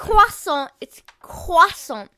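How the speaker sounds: croissant as kwah-SAH, slow and loud; drawn-out French croissant; French accent. Shown as a demonstration. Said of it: "Say it, say it".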